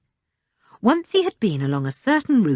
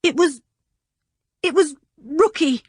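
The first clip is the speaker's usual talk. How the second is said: trembling voice; 10 semitones higher than usual. A narrator's reading. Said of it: "in a trembling voice".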